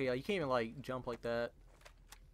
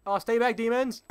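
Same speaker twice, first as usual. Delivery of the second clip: loud, raised-pitch warning; warning off enemies; 7 semitones higher, 8 dB louder than usual. Real, not acted. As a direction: call out to hold them back. Warding off enemies in game.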